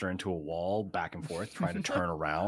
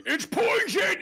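high loud yell; 7 dB louder, slower than usual. Acted. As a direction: shout the warning over and over. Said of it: "like, yelling".

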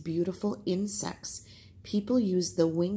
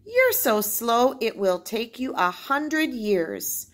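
slow, teasing scorn, higher; mocking; 5 semitones higher, 6 dB louder, slower than usual. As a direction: drag it out to mock the slowpoke. Paca mocks the slow beetle.

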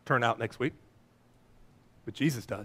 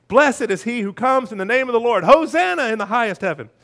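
raised, louder scripture shout; crowd shouting; 5 semitones higher, 6 dB louder than usual. A narrator's reading. Crowd hailing the Son of David, raised jubilant shout. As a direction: proclaim it high and loud like a cheering crowd. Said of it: "those who followed shouted".

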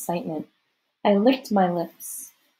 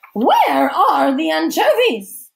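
loud, high, drawn-out demand; theatrical indignant bellow; outraged hostess; 8 semitones higher, 8 dB louder, slower than usual. A narrator's reading. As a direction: complain like an imperious society lady. Hostess complaining about her salad.

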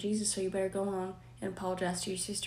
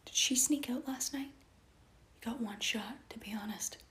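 whisper; 2 semitones higher, 7 dB quieter than usual. Acted. Mom quietly pressing her son, whispered. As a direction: whisper the warning close.

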